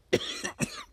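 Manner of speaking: single dry cough. Real, not acted. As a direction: clear the throat with a cough. Cough mid-sentence, then "perdón.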